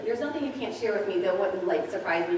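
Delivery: ordinary delivery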